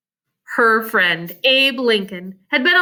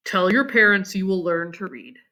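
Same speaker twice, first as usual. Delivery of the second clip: Abe; 6 semitones lower than usual. Acted. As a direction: lower kind boy's voice. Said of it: "he said".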